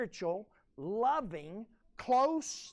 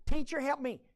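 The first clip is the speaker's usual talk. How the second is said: higher, louder mock plea; mock pleading; 5 semitones higher, 7 dB louder than usual. Self-absorbed man appeals to the teacher, pleading. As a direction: play the self-centred complainer begging for help.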